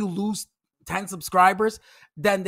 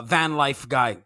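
usual speaking voice

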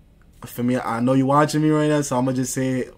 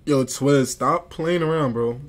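upset; 3 semitones higher, slower than usual. Real, not acted. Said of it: "You're getting me upset".

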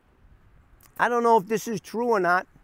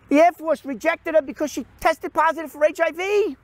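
ordinary delivery

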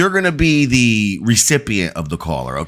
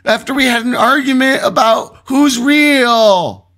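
much higher, nervous prank caller; panicked caller voice; 13 semitones higher than usual. Acted. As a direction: play a frantic caller. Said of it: "I'm supposed to be in a panicked voice".

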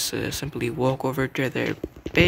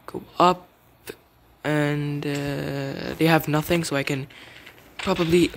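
ordinary delivery

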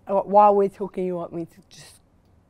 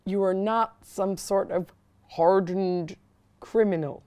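put-on Philadelphia accent; 7 dB louder, slower than usual. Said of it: "Like that?".

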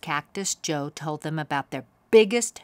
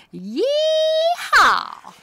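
very high, louder held cowboy whoop; yee-haw shout; 8 dB louder than usual. Acted. Boy cheering on the clown's bike, whooping. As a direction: whoop it out with joy. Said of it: "shouted Cameron".

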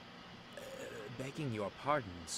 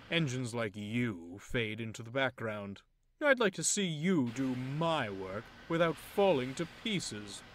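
louder, slow, lofty bragging; arrogant boast; 4 semitones higher, 6 dB louder, slower than usual. Acted. Loaned engine belittling Edward, arrogant. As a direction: lord it over the old engine.

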